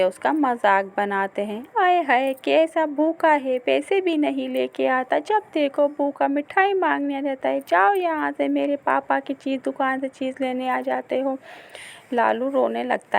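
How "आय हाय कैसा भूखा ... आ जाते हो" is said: mocking children. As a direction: sneer at the hungry boy. Children mock hungry boy. (भूखे बच्चे का ऊँची आवाज़ में मज़ाक उड़ाते बच्चे)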